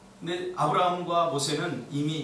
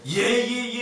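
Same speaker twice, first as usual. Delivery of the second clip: higher, mock-eager yeses; put-on dutiful assent; feigned belief; 6 semitones higher, 4 dB louder, much slower than usual. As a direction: rattle off agreement as if only pretending to believe. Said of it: "겉으로는 믿는 척하고".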